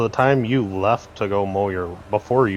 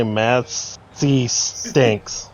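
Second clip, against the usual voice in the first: drawn-out s like a snake; much slower than usual. Pretend. Mocking the rival tractor brand.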